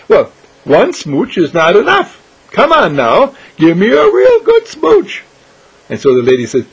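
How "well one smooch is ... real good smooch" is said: high, loud wheedling coax; playful teasing. Acted. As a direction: coax her for a bigger kiss. Irene teasing for a smooch.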